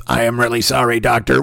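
lightly voiced robot confessing; robotic penitent. Strange-voiced guest confessing in a booth, light robot impression. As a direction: confess in a mock robot monotone. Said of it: "imagine that guy wander into a confession booth".

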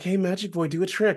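put-on high girly voice. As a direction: play it like giggly schoolgirls. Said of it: "they're like".

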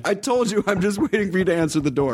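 high, laughing, playful phone voice; giggly late night call. Pretend. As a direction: giggle through a creepy lover bit. Stalker-lover bit, laughing.

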